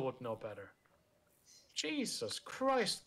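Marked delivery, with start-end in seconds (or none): 1.8-3.1 exasperated drawl. Real, not acted. Exasperated at profile.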